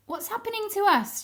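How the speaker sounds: high, fast panicky cry; frightened shout. Acted. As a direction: cry out in alarm. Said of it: "shouted P C Pot, shivering".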